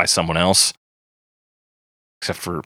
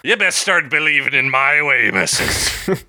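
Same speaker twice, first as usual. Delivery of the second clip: pirate voice; 6 semitones higher than usual. Pretend. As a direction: growl it like a pirate singer. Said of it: "he sounds like a pirate".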